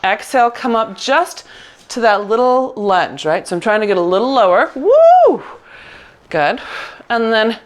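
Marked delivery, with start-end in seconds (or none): 4.8-5.4 excited cheer. Real, not acted. Cheering exertion in class.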